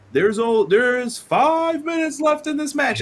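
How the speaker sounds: high, slowed announcer call over the arena; ring announcer call. Retold or imitated. Ring announcer warning the wrestlers, big announcer call. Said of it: "when you would hear".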